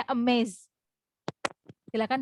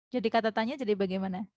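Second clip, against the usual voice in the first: ordinary delivery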